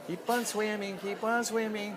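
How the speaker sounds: high sing-song little fish. Acted. Dad voicing a swimming fish for his kids, high sing-song. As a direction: chirp it like a cheerful fish.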